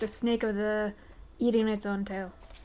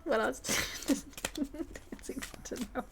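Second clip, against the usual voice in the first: 1 whispered question; 3 semitones higher, 10 dB quieter, faster than usual. Real, not acted. Keeps whispering after laugh.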